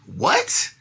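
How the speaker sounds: sudden high-pitched disbelieving what; shocked what. Real, not acted. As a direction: squeak out a stunned what. Shocked by the quoted threats.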